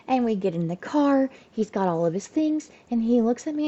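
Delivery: normal talking voice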